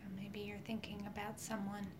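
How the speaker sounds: soft whispered meditation voice; right in your ear. Acted. Leads a guided healing meditation.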